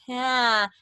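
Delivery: bright-placed, loud open calls. Shown as a demonstration. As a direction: open up and let it ring in the face. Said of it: "I'm suddenly more open, like".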